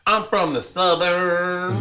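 high, drawn-out mock Southern accent. Daughters making fun of mom's Southern accent, mock Southern drawl. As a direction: stretch out an exaggerated Southern accent. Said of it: "they used to make fun of it".

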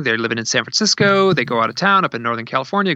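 usual speaking voice

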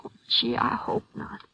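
a soft, barely voiced whisper; whispered hope. Worried wife answering the ranger about her husband, soft whisper. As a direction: breathe it out as a fragile hope.

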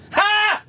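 sharp, high burst of laughter; sudden loud laugh. Retold or imitated. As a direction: explode into one loud laugh. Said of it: "some people are like".

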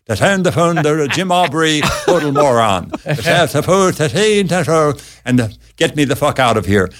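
mock Swedish gibberish; hammy fake Swedish accent. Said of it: "talking some fake Swedish voice".

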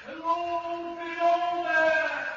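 high, long-drawn distant call; haunting sustained cry, held on one high note. Acted. Signalman recalls a voice heard by moonlight, crying out. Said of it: "I heard a voice cry out".

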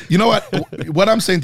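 laughing through the words; giggly, high and loud. Real, not acted. Still laughing through banter.

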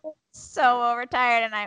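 usual speaking voice

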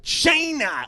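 China punched high and drawn out, derogatory; mock a sneered, high "CHY-na"; Trump. Panelist imitating how the president says China, derogatory. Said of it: "in a way that sounds derogatory".

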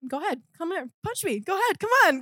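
high, light taunting rush; re-enacted goading, pitch climbing; daring. Student retelling how they invited a punch, taunting. Retold dare to fight.

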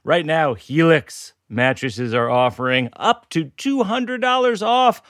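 high, projected sales pitch; announcer ad read. Real, not acted. Host announces mattress offer to listeners, high announcer delivery.

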